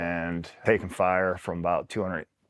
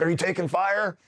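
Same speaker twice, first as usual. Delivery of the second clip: imitated urgent question; 10 semitones higher than usual. Acts out commander's worried question.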